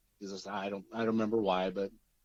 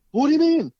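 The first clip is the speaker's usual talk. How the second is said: imitating a panicked father, much higher and louder; panicked dad; 11 dB louder than usual. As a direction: squeal it out like a frantic dad. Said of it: "he's in a panic".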